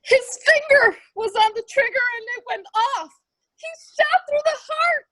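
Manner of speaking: crying, voice rising high; words breaking into tears; tearful. Acted. Wounded man telling the lady of the death, crying.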